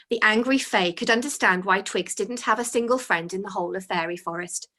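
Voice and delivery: usual speaking voice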